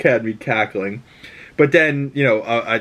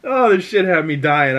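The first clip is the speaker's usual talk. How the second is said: ordinary delivery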